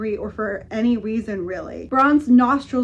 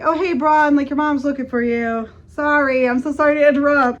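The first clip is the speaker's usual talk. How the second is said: higher, louder, apologetic, fast at the end; chirpy intruder; 4 semitones higher, 5 dB louder than usual. Retold or imitated. Melissa interrupting the kiss, bright and apologetic. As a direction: gush an awkward apology.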